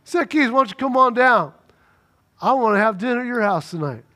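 Jesus calling Zacchaeus down from the tree. Retold or imitated. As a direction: call out to a man up in a tree, friendly.

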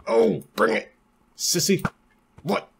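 high-pitched playful challenge; mock taunt. Player dares enemy to fight, mock taunting. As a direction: goad the enemy in a silly high voice.

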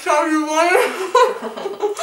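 slow, laughing through a stuffed mouth; mouth full. Real, not acted. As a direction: barely get it out past marshmallows.

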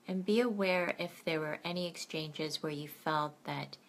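gentle bedtime meditation guide; guided meditation. Acted.

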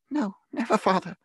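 put-on high, earnest childlike protest; children crying out. A narrator's reading. Children reassuring their father, crying out. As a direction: cry it out in a high voice. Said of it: "they all cried".

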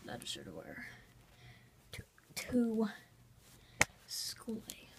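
soft unvoiced whisper. Real, not acted. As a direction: whisper it to yourself. Whispering while choosing a shirt.

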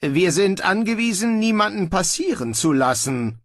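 high-pitched, hesitant objection; unsure. A narrator's reading. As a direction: protest uncertainly to someone giving orders. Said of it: "brachte er unsicher hervor". (unsicher vorgebracht)

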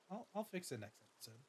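usual speaking voice

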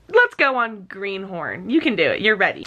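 ordinary delivery

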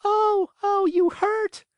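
crying tiny voice. A narrator's reading. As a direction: cry out in pain like a tiny voice. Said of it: "cried the same faraway little voice".

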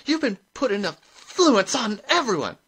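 high, loud outrage; shrill, enraged challenge; furious accusation. A narrator's reading. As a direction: demand it in a shrill, angry shout. Furious rival accuses Granny.

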